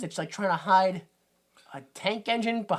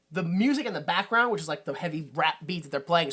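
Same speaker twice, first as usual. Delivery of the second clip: ordinary talk, nothing marked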